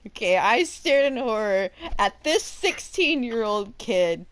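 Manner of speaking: ordinary delivery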